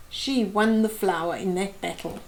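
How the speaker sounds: ordinary delivery, nothing marked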